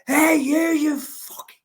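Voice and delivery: high, loud, full-on Scouse yell; best Scouse accent. Retold or imitated. Small Scouser challenging a huge man kicking his dog, loud Scouse accent. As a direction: put on your strongest Scouse and shout it. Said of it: "in me, like, the best Scouse accents".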